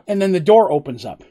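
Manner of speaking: ordinary talk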